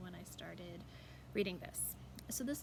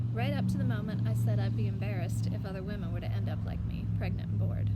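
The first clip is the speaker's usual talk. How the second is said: loud; 13 dB louder than usual. A narrator's reading. Climax of narrated fight.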